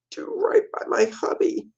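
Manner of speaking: a tearful whisper at the end; whispering wife, worn out. Acted. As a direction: breathe it out, spent from crying. Wife trailing off in tears.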